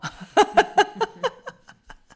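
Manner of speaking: high, loud peal of laughter; uproarious laugh. Real, not acted. Interviewee tickled by the "cracra" jibe at Swiss German, uproarious laugh. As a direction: burst into long, loud laughter.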